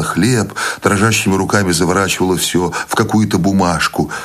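plain reading voice

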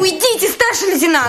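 high-pitched urgent plea to leave; heated, high-voiced protest; agitated demand. Nurse orders officer out of quarrel.

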